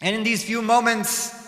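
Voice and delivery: usual speaking voice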